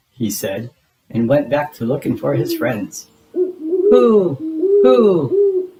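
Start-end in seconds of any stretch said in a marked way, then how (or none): 3.3-5.7 high, hollow ghostly hoot; strange hooting. A narrator's reading. A mystery caller answering Big Bird, high ghostly hoot. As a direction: hoot like something unseen in the trees. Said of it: "There it was again".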